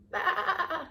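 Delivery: high bleating lamb voice. Acted. Lamb tempting the coyote in high bleats. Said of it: "Boragita bleated".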